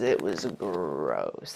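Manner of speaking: slow, low, dragged-out spirit voice; how she sounded. Retold or imitated. Medium voices the dead mother to her son, slow and low. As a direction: let it sink slow and low, like a voice from far off. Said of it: "That's how she sounded in my brain".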